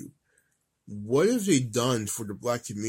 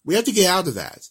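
loud urging; 6 semitones higher, 6 dB louder, faster than usual. Real, not acted.

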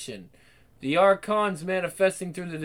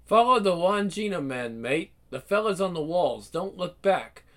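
ordinary talk

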